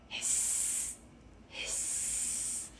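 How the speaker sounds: soft whispered hiss; breathy kettle hiss. A narrator's reading. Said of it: "The tea kettle whistled".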